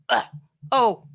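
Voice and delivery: jolted exclamation after a collision; bumped in basketball. Shown as a demonstration. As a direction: take a hit and let it out loud. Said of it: "like your brother just bashed into you in basketball".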